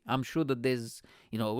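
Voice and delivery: normal talking voice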